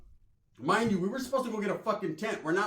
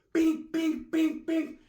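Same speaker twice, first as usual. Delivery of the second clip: punch sound effects; 10 semitones higher, 3 dB louder, much slower than usual. Retold or imitated.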